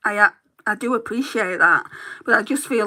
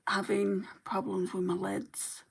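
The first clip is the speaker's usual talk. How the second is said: slow whispered aside; whispering; 12 dB quieter than usual. Real, not acted. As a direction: whisper it to yourself, slowly. Muttered aside about stuck lid.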